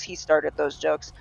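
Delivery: normal talking voice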